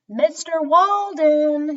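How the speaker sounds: loud, slow, high call across the grounds; shouting. Acted. Clown calling the circus owner, loud and drawn out. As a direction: call out for the boss. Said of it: "he shouted".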